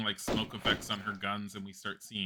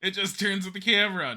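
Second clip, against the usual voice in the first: higher, louder words through laughter; amused gamer laughing; 9 semitones higher, 7 dB louder than usual. Real, not acted. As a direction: laugh as you point it out. Laughing at flat object.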